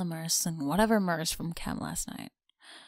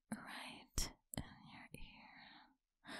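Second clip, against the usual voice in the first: barely audible slow whisper; right in your ear; 18 dB quieter, much slower than usual. Acted. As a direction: breathe it slowly into the ear. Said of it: "so I can whisper".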